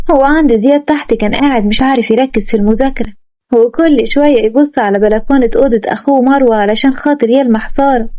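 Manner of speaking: ordinary delivery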